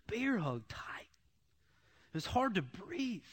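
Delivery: whispered, slowed. Real, not acted. Telling the congregation about God's embrace, whispered. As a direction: breathe it out in a whisper.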